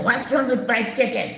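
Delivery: mock a defensive woman; offended retort. Church woman defending her fried chicken to the nurse, mildly offended. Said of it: "she was offended".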